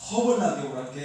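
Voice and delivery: booming Jeolla greeting; Jeolla dialect. Shown as a demonstration. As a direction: call them in with a big Jeolla welcome. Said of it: "전라도는 어떻게 하죠".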